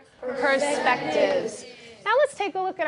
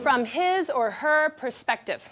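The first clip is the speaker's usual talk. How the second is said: model reading. Shown as a demonstration. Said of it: "I'll read, then you're going to read".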